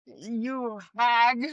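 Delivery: put-on old lady voice. Shown as a demonstration. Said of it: "There we go".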